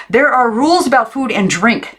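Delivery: put-on high, strict old aunt; stern aunt. A narrator's reading. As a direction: lay down the food rules. Second aunt states rules.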